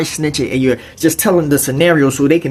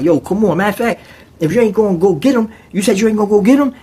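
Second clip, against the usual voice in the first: a bit higher and faster, pressing; mild pushy inmate voice; 7 semitones higher, faster than usual. Retold or imitated. Inmates pushing the roommate, lightly voiced and quick. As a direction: urge him on, half-voiced.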